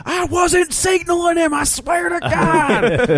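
very high, slow pleading voice; high-pitched imitation, dragged out slow; high squeaky quote. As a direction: squeak it out like a panicked guy. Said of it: "where he's just like".

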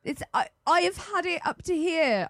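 high-pitched mock outrage; retold anger. Player retelling her stand against him, high angry outburst. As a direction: play fed up, pitch shooting up. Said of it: "I was angry".

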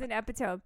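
"epitome" said as EP-ih-tome; "epitome" with a silent final E; mispronounced epitome. Retold or imitated. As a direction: end "epitome" like the word tome. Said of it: "pronounced epitome".

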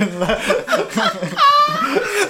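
high helpless laughter; shrieking laugh. Real, not acted. Laughing at the story.

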